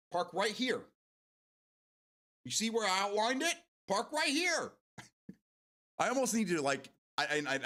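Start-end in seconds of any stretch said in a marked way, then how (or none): 0.1-0.8 directing traffic. Pretend. Mock directing traffic to parking spot. 2.4-4.7 high, raised-voice mock exasperation; play-acted loud, high parking order; exasperated traffic directing.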